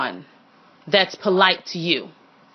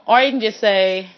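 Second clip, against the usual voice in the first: usual speaking voice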